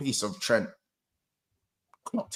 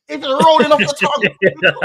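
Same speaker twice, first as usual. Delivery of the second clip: laughing amazement; 10 semitones higher, 7 dB louder than usual. Real, not acted.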